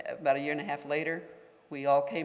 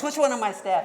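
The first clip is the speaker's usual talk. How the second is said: higher and louder retold demand; imitating the boss snapping at his staff; angry outburst; 9 semitones higher, 8 dB louder than usual. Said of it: "he was just so angry".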